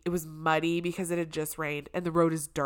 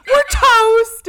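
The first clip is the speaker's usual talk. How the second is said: high, loud comic wail; despairing outburst; 13 dB louder than usual. Retold or imitated. Niece reacts to aunt swearing in prayer, loudly despairing. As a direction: shout it in mock despair.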